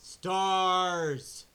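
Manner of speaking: shouted echo; loud exclaim. Real, not acted. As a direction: belt out the word. Echoes stars, loud.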